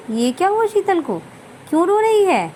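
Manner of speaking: put-on high, sharp, startled older woman; a shocked in-law voice pitched well up; surprised mother-in-law. Mother-in-law startled by crying bride, high and sharp.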